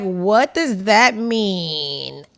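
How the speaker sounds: slow drawn-out mock bewilderment; mock clueless. Imitating someone pretending not to get the joke, slowly. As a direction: act clueless, slow and puzzled.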